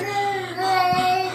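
sharp high gasp of fear; frightened gasp. Retold or imitated. Mother gasping as the stranger threatens her baby, frightened. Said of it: "I'm like".

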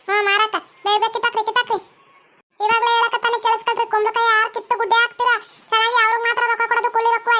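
high, impatient farm boss voice; bossy farm owner. Acted. Farm boss ordering workers to pick pumpkins quickly, impatient. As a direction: hurry the pickers along in a put-on high voice.

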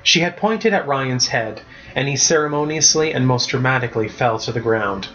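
ordinary delivery, nothing marked

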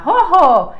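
"Jojo" for rojo, breathy h replacing the trill; failed Spanish R. Retold or imitated. Learner answering teasers, "Jojo" with no rolled r. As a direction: say "Jojo" high and loud with an h. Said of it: "Y yo decía".

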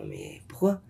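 very low, whispered asking; hushed question. Retold or imitated. Angels questioning the tzaddik, hushed and low. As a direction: murmur it like a secret.

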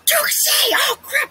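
high, loud excited cry; shrieking the name in delight. Real, not acted. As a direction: yelp with sudden excitement.

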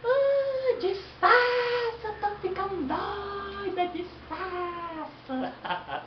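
put-on high, slow drunken squeal; tipsy woman voice. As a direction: squeal like she's getting tipsy.

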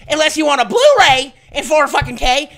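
ordinary delivery

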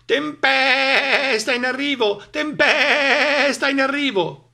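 slow, loud, high bleat; bleating sheep. A narrator's reading. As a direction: stretch the words like bleating sheep. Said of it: "belarono le pecore". (pecore che belano spaventate)

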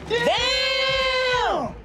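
shouted damn; loud exclamation. Real, not acted. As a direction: blurt it out loud. Reacting to a big burp.

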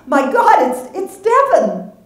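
very high, loud, slow stunned exclaim; seeing a ghost in the street; shocked cry. Acted. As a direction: blurt it out in disbelief. Marcy sees her dead daughter.

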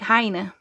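"Heine" said clearly as HY-nuh; name pronunciation demo. Shown as a demonstration. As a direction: show how "Heine" is pronounced, two syllables. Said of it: "you pronounce it like".

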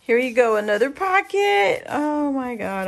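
loud, high burst of delight; thrilled. Real, not acted. Crafter finding another pocket, thrilled. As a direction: exclaim it, overwhelmed by the find.

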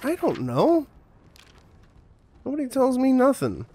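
mock high voice. Repeated in put-on high voice.

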